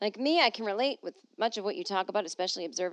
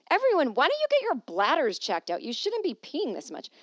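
scolding; 6 semitones higher, 5 dB louder than usual. Acted. Scolding address to listeners.

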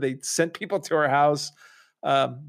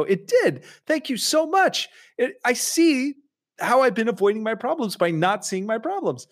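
high, gushing fake gratitude; mock gratitude; 9 semitones higher than usual. Mocking the recruiter with gushing high thanks. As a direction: ham up being converted, voice lifted high. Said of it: "And I go".

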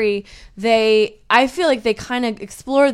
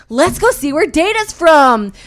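eager crew voice; 7 semitones higher, 8 dB louder, slower than usual. Retold or imitated. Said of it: "they're like".

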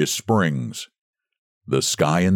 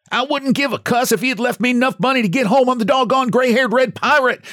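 high, fast, shrill fury; shrill it out in a seething rush; little man raging about the hermit; shrill seething rant; faster than usual. A narrator's reading. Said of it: "he shrilled in a seething sentence".

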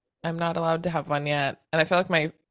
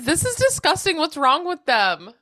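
much higher, mock-disgusted "This is disgusting"; disgusted coworker; 9 semitones higher than usual. Coworker tasting the gross mozzarella stick, high disgusted squeal. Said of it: "She's like".

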